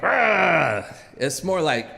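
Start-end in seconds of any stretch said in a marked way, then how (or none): 0.0-0.8 rough mock growl. Coach's old greeting to the kid, mock growl. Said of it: "growling at me like".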